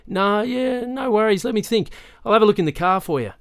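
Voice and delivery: lightly voiced cop, pitched well up; easygoing and eager to help, high and loud; obliging officer. A narrator's reading. Officer cheerfully reassures the kids.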